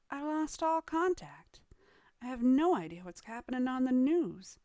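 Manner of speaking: high, fretful whine of regret; mock complaining. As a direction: act out someone fussing over lost comforts. Acting out frustrated person missing box.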